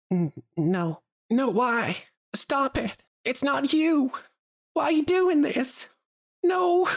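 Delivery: high, frightened whimpering pleas; whimpering in sleep. Acted. Lulu pleading in her nightmare, high frightened whimpering. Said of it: "whimpering sound".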